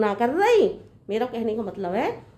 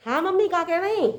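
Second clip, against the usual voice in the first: ordinary talk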